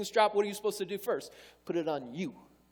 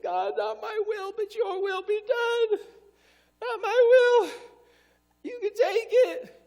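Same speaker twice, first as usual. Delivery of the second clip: high, drawn-out wailing plea; loud, hammed-up sobbing surrender; mock crying prayer; 10 dB louder, slower than usual. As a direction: imitate someone tearfully crying out to God. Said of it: "people praying, I'm praying, I'm crying".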